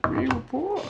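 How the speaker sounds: high, whiny mock lament; overplayed sorrow; mock pitiful wail. Joining the mock sob story.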